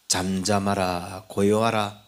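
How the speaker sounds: slow, low command to the wind; Jesus stilling the storm, slow and low; commanding the sea. Retold or imitated. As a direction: speak slowly, as Jesus rebuking the sea. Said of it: "바다를 꾸짖고".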